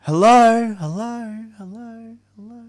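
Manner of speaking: high call fading away like an echo; high-pitched hello echoing into the distance. Acted. Calling into echoing empty body space.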